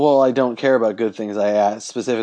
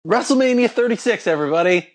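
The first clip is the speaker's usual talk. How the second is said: high hammed-up ring announcer; 10 semitones higher than usual. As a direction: belt it out like an arena host. Mock announcer callout.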